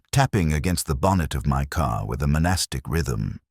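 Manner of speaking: ordinary delivery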